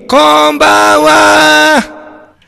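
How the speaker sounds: 「こんばんは」を高く大きく長く伸ばす; 元気いっぱいに声を張り上げて、ゆっくり挨拶; 元気な挨拶. Shown as a demonstration. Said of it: "今夜も元気に". (ラジオ番組の元気な呼びかけ)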